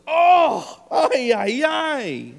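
high, loud mock groan of despair; exasperated Jesus. Preacher voicing Jesus, fed up with slow disciples: mock exasperated groan. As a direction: play Jesus throwing up his hands at them. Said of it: "And Jesus goes".

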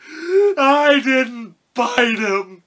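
loud, high, drawn-out wail; wailing cowardly lion. A narrator's reading. Lion protests after Dorothy slaps his nose, loud drawn-out wail. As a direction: cry out in slow, hurt protest.

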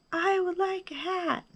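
high, soft echoed answer; small distant high voice. A narrator's reading. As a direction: repeat it like a faraway echo. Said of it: "his own voice echoed".

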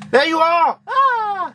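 high, loud frightened shriek; startled scream. Real, not acted. Scared shriek in game.

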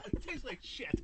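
whispered disgust. Real, not acted.

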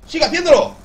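high, loud hype shout. Real, not acted. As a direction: scream it to pump yourself up. Eres una máquina motivando.

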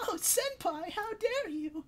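put-on shrill sobbing anime girl; overacted anime dub. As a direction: wail it like a melodramatic anime heroine. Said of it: "it's anime shit".

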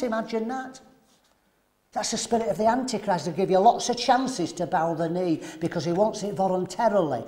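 ordinary delivery

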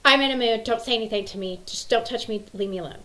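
starts loud, eases off; imitated moody child. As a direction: mimic a grumpy kid warning everyone off. Moody daughter snapping at siblings.

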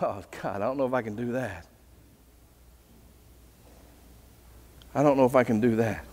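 very soft, low, hesitant reply; reluctant doubt. Retold or imitated. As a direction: back away quietly from the challenge. We hesitate at God's command.

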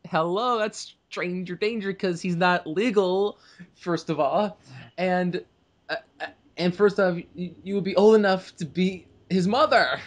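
high-pitched, incredulous protest; mock outrage. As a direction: object as if scandalised, voice held up high. Indignant mock objection to film romance.

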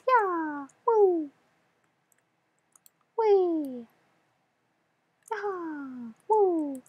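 high, bright-eyed cheering whoops; high inner child voice. Acted. Player whooping through the level as his inner child, in a high voice. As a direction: whoop like an overexcited little kid. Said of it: "back to my regular voice".

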